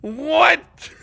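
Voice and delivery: high, loud disbelieving what; shocked shout. Real, not acted. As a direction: blurt it out in disbelief. Shocked outburst.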